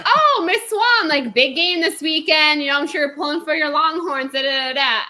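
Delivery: butter her up with sing-song sweetness; mock-sweet greeting. Employees teasing their boss about the game, sugary high voice. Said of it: "so we're like".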